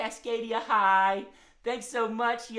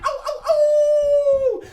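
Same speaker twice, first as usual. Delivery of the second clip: high, very loud long howl; excited dog howl; 14 dB louder, much slower than usual. Pretend. Worked-up hound dog among friends, howling. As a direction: yelps running into a drawn-out hound howl. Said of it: "he's so excited".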